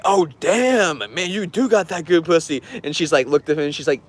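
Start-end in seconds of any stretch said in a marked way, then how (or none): 0.0-2.6 impressed dude. Retold or imitated. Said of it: "And he's like".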